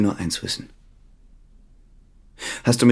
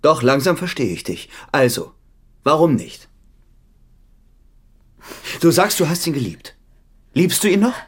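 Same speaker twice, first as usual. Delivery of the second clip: angry, building; 6 semitones higher than usual. Acted. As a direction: press her harder with each question. Husband's jealous quarrel building.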